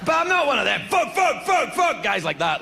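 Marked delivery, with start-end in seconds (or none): 0.9-2.0 high, repeated swearing as barks; barking like a dog. Acted. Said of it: "like a dog".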